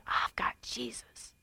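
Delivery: soft slow whisper of a secret; woman whispering her answer to a scary man. Retold or imitated. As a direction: breathe it out slowly, very soft. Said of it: "I said".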